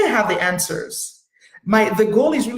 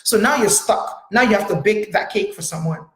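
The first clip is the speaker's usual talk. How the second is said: ordinary talk, nothing marked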